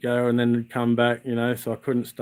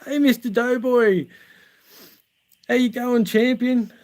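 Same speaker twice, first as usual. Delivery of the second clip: much higher, bright mock greeting call; cheery greeting; 11 semitones higher than usual. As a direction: sing out a hearty hello to a mate. Greeting called out on arrival.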